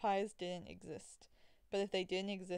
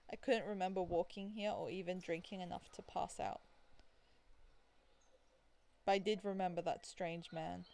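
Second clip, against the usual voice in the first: normal talking voice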